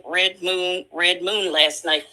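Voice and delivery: ordinary talk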